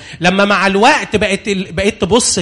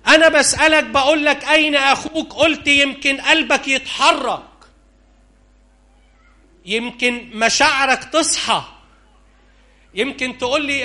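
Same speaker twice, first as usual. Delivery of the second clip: pleading voice of God; 7 semitones higher than usual. Acted.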